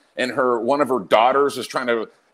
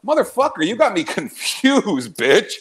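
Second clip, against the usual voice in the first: raised, higher retort at the uncle; imitating telling off a relative, higher; mock outburst; 5 semitones higher than usual. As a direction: fire it back at the pushy uncle. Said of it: "I'd be like".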